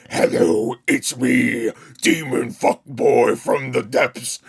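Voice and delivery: slow, hammed-up movie demon; mock demon voice. Movie demon introducing itself, hammed-up demon voice. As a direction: parody a demon from the depths, drawn out. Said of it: "Why do they always have to have the demon voice".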